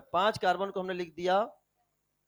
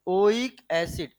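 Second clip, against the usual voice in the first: slow dictation; 2 semitones lower, much slower than usual. Shown as a demonstration. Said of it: "आ जाएगा बेटा क्या?".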